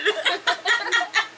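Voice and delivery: high giggling fit; real laughter. Real, not acted. Reader amused by the mud fall, laughing.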